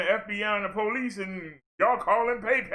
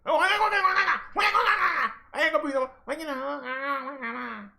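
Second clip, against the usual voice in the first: mock hesitant woman; 5 semitones higher than usual. Mocking a woman who can't get her anger out, high and trailing. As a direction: fumble and trail off.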